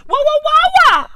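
rapid-fire, very high-pitched disbelief; squawking bafflement. Acted. Comedian failing to understand Merseyside speech, squawking bafflement. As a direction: yelp it over and over way up high.

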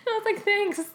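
high voice squeezed by laughter; laughing. Real, not acted. Daughter retelling her reply to dad's joke, laughing. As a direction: get the words out through a laugh.

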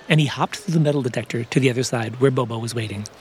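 usual speaking voice

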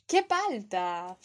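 qué palta drawn out with cringe; slow embarrassed qué palta. Shown as a demonstration. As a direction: cringe at the awkward moment. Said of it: "tienes que empezar por".

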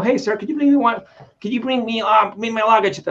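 light mock American accent. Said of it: "llegas con un acento gringo".

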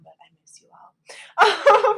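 whispered through a laugh. Real, not acted. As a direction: giggle it under your breath. Playful aside to listeners.

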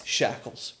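ordinary talk

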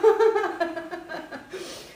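high loud laugh; burst of laughter. Real, not acted. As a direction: laugh at yourself. Laughs after own shout.

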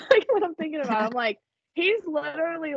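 quick, high laughing words. Real, not acted. Sharing her faith with her friend, laughing fast and high. Emotional laugh while talking about God.

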